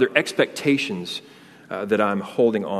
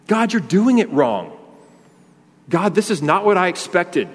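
acted complaint; 7 semitones higher than usual. Voicing a grumbler complaining to God.

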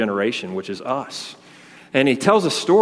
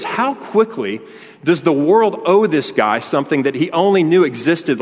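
incredulous; 5 semitones higher, 4 dB louder than usual. Retold or imitated. Retells comedian's indignant punchline.

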